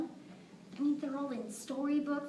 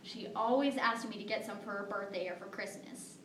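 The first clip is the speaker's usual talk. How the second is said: ordinary delivery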